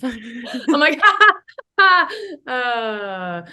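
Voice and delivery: words giving way to hysterical laughter; hysterical laughing. Real, not acted. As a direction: crack up helplessly on the words. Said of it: "that's why I'm laughing hysterically".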